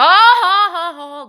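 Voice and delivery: high, loud burst of laughter; delighted laugh. Real, not acted. Laughing at baby.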